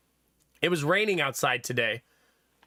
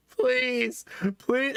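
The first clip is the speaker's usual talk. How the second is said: put-on high pleading child voice; imitating a tearful little boy begging; begging toddler; 6 semitones higher than usual. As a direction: beg like a small kid. Child begging for Pop-Tart.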